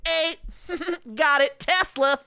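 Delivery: high, eager blurted answer; eager know-it-all. Retold or imitated. A hint of the keen kid blurting out answers in class. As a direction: jump in before anyone else can. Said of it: "he's like".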